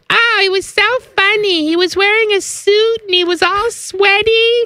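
put-on high, breathless teen girl; high girlish daughter voice. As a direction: gush like a teenage daughter. Daughter quoted in story.

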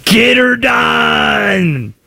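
very slow, high, hammed-up Southern holler; Southern accent. Host showing how Europeans fake a Southern accent. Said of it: "It's always the Southern accent".

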